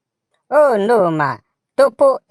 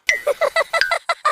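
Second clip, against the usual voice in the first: high laugh. Real, not acted. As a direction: burst of high-pitched laughter. Leader bursts out laughing.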